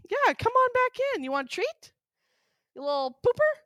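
high coaxing pet voice; talking to a dog. Real, not acted. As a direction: coax the dog back in. Coaxing wet dog inside.

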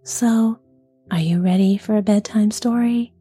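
soft, gentle whisper; soft bedside voice. Real, not acted. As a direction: whisper it gently, like tucking someone in. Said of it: "with a nice, soft, gentle voice".

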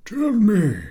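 put-on kindly old professor coaxing, high; encouraging. A narrator's reading. Old professor encouraging a shy schoolboy, coaxing. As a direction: gently coax the shy pupil. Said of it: "said the professor encouragingly".

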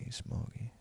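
barely audible, breath-only reply; whispered from the edge of sleep; near-passed-out murmur. Retold or imitated. Wasted Jay in bed, barely talking.

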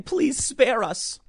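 high, slow, pleading mock; mock plea. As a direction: beg for mercy in parody. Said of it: "signs saying".

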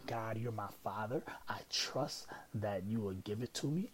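normal talking voice

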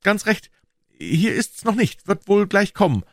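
forced cheerful reassurance. A narrator's reading. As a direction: sound bright to calm her. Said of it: "sagte regelmäßig ganz heiter".

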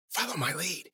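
very soft, hushed instruction; whisper. A narrator's reading. Ben to Cece in hiding, whispering. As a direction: whisper it urgently to someone close. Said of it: "he whispered".

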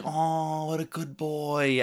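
put-on cooing over the good boy dog; doting on the dog. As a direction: coo it like you're praising a puppy. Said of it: "And I was like".

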